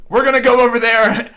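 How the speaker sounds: mocking Trump's rally boast, loud and brash; Trump impression. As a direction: bellow it like a rally speech. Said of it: "he goes".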